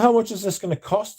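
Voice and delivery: incredulous. Retold or imitated. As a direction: ask the price as if you can't believe it. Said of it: "I was like".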